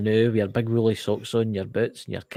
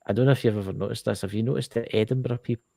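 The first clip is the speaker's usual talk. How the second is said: normal talking voice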